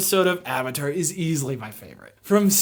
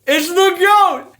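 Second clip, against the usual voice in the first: emphatic; 11 semitones higher, 6 dB louder than usual. Real, not acted. Echoed catchphrase.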